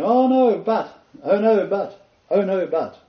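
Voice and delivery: high, whiny imitation of objecting students; hammed-up students going oh no, but; mock protest. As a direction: mimic flustered students cutting in.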